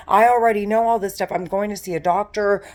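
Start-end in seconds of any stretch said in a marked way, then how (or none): 0.1-1.3 loud, fast angry burst; angry outburst. Real, not acted. Ranting to camera about her torment, loud and fast.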